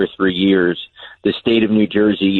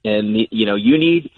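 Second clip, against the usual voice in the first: normal talking voice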